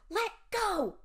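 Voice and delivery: high, forceful shout. A narrator's reading. Amanda to Mister Dawes gripping her shoulder, shouting. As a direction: shout to break free. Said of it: "I shouted".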